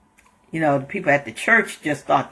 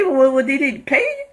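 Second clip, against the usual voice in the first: voicing the truck driver; 9 semitones higher than usual. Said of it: "the truck driver's asking him".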